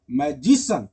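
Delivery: Magician said loud, stress on जि; stress on middle syllable. Shown as a demonstration. Teacher showing the class where magician takes its stress. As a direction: punch the जि in magician. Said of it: "उस पे आप जोर देते हैं".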